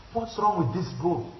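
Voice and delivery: high, incredulous what's wrong with this book; baffled question about a book; puzzled reader. Acted. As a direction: ask it confused and exasperated.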